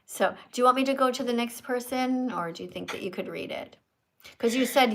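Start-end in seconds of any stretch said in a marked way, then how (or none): none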